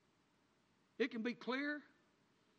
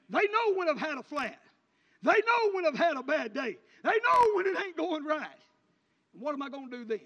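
loud, high, building preacher's cry; full-force preaching; 5 semitones higher, 11 dB louder than usual. Acted. Preacher to the daddies, shouting it up high. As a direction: drive it home to the congregation at full voice.

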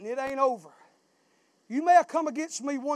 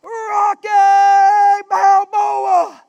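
calling a name from outside; hollering a name; 4 semitones higher, 10 dB louder, much slower than usual. Retold or imitated. Someone outside hollering for Rocky, loud call. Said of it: "he hollered".

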